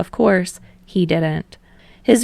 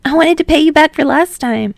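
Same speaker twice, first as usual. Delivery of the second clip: put-on high, louder, bright young voice; eager Hinata; 9 semitones higher, 6 dB louder than usual. Hinata explaining the drink to Tsukishima, high and bright.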